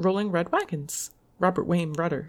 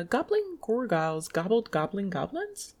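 normal talking voice

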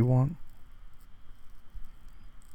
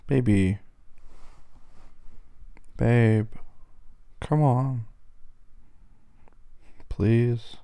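raised voice, calling to someone who won't answer; much slower than usual. Acted. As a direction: call for attention, a bit raised. Calling to silent upset partner.

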